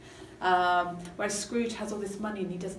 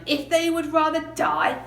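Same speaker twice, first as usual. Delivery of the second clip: a touch of Scrooge, higher and louder; loud, raised character reading; lightly voiced Scrooge; 8 semitones higher, 6 dB louder than usual. Reading Scrooge on the poor, lightly acted indignation. Reads Scrooge's callous famous line.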